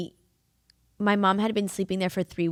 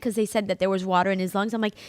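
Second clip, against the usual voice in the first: usual speaking voice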